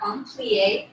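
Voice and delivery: ordinary talk, nothing marked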